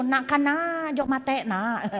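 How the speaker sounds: lilting Madurese call, falling at the end; Madurese accent. Shown as a demonstration. Preacher repeating how Madurese talk to a child, Madurese accent. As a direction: mimic a Madurese parent's sing-song. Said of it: "Madura kan gitu".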